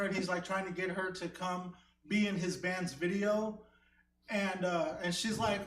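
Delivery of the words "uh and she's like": ordinary talk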